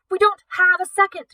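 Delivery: very high, loud, panicked yell; frantic yell. Acted. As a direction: yell it over the alarm. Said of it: "Kat yelled".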